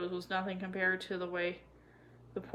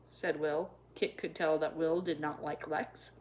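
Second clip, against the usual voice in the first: plain reading voice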